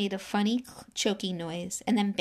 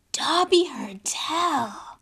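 slow, hoarse whisper; 8 semitones higher, 7 dB louder, much slower than usual. A narrator's reading. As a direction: lean in and rasp it secretly. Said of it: "he said hoarsely".

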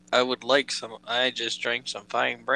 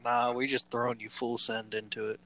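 ordinary delivery

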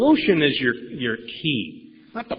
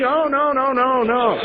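high, louder playful denial; mock innocent denial; 11 semitones higher, 5 dB louder, slower than usual. Sponsor pretends to the sponsee he is not taping, mock innocent. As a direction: play it falsely innocent and high.

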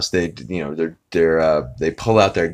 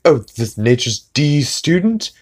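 higher, louder mock-snooty retort; snide Stewie; 8 semitones higher, 4 dB louder than usual. Stewie mocking the turtle floaty, higher snooty voice. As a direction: sneer at the floaty.

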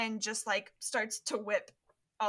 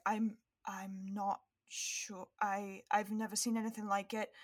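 hushed uncertainty; 6 dB quieter than usual. Acted. Hermione answering Ginny about the strange thing, hushed. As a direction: falter softly, unsure of it.

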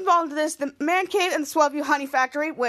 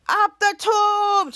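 slow, louder mock call; imitating Schwoz; 5 semitones higher, 4 dB louder, much slower than usual. Schwoz sending the dog down, mock call. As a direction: stretch out the cheer. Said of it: "He was like".

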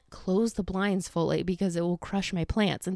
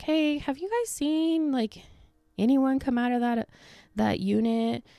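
a hint of a higher polite officer; officer asking; 7 semitones higher than usual. Police officer at the door, lightly voiced higher inquiry. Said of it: "she's like".

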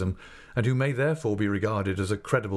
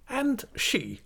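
very high, pointed demand; sharp; much slower than usual. A narrator's reading. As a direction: press sharply, drawing out the question. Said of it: "said my aunt sharply".

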